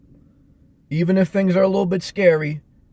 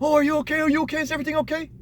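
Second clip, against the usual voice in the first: high, fretful mother's voice, imitated; anxious mother; 11 semitones higher, much faster than usual. Son imitating his anxious mother, high fretful voice. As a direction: imitate a worried mom fussing. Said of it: "I know she would have went".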